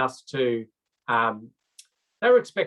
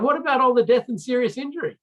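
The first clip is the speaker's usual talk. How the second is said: much higher and louder, aghast; incredulous outburst; 12 semitones higher, 5 dB louder than usual. Retold or imitated. Dutch engineer appalled at the road risk, high incredulous cry. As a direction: blurt it out in sheer disbelief.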